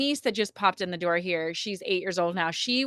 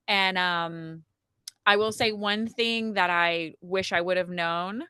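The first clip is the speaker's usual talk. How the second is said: normal talking voice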